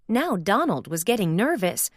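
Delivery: plain reading voice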